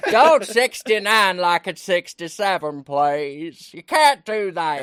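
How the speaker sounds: a hint of a stump speech plea; Hillary Clinton voice. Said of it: "That's a better Clinton".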